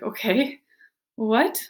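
sudden loud disbelief, rising; shocked. Retold or imitated. Telling how she reacted to his HR news, loud shocked question. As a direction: blurt it out in shock.

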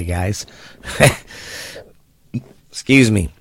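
ordinary talk

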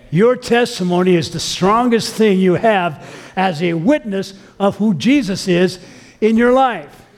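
slow, emphatic preacher voice; deliberate sermon emphasis; slow preaching. Real, not acted. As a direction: press the point home to the congregation.

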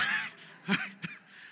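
sudden high-pitched laugh. Real, not acted. Pastor teasing a boy in the crowd, high laugh. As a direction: burst out in a squeaky laugh.

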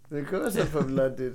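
a lightly put-on British accent, soft; British accent, understated and quiet; mock British accent. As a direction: tease the friend in a fake British accent. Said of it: "British accent".

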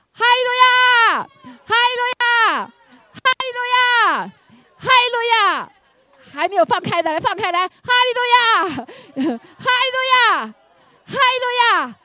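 high, loud, drawn-out hallelujah shouts; congregation-leading praise shouts; full shout. Real, not acted. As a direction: lead the room in shouting praise. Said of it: "我们大声呼喊".

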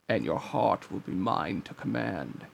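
low, soft, menacing villain; Ansem. A narrator's reading. Villain claiming Riku's heart from the darkness, low and menacing. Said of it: "As the voice said that".

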